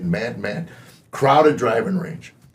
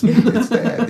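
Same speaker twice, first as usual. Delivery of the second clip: laughing; 13 semitones higher, 6 dB louder than usual. Retold or imitated. Dad apologising to crying sons.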